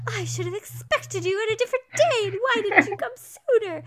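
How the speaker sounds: high, loud, petulant complaining sister; whiny Mary. Retold or imitated. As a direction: huff it out like a spoiled sister, fast. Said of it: "and Mary is like".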